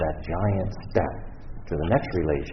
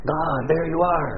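thrilled discovery; 10 semitones higher, 3 dB louder, slower than usual. Retold or imitated. Finding God in the muffler.

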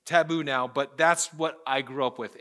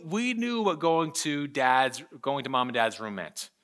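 ordinary talk

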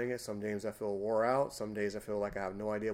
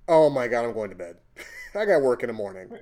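retold exasperation; 9 dB louder than usual. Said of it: "And he goes".

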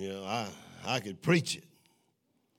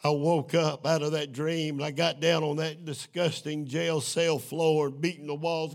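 normal talking voice

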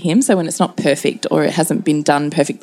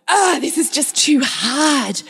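high, slow mock wail; hammed-up frustration; 8 semitones higher, slower than usual. Mother slamming a homework book for her son, hammed-up frustration. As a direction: groan it out high like a defeated pupil. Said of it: "this problem's really annoying".

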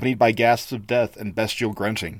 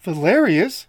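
a higher, hesitant stammer of the name; 7 semitones higher, 4 dB louder, slower than usual. Acted. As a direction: stammer it in disbelief. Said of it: "she stammered".

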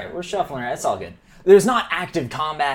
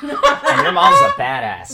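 laughing praise; 12 semitones higher, 8 dB louder, slower than usual. Real, not acted. Laughing loud emphatic praise.

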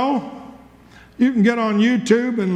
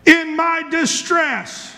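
drawn out, pitched higher; slow, raised quote; 4 semitones higher, slower than usual. Retold or imitated. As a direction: stretch the scripture out, a bit higher. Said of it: "he said".

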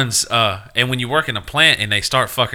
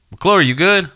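calling out; 10 semitones higher, 6 dB louder, slower than usual. Retold or imitated. Retold call to coworker.

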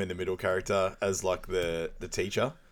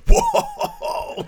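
a huge, high, loud whoa that cracks into a laugh; startled whoa; 9 dB louder than usual. Retold or imitated. Retelling the diver scene, a high, loud startled whoa. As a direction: yelp it like a startled stoner. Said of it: "just looks at him and goes".

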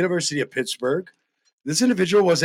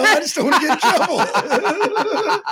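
high, laughing retake; 9 semitones higher than usual. Shown as a demonstration. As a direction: say it laughing, pitched up. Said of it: "I said".

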